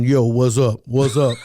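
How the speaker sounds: low, loud macho greeting; booming put-on male voice; deep man's voice. Shown as a demonstration. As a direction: drop it deep and loud, like a man. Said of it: "I got a really deep voice. I can sound like a man".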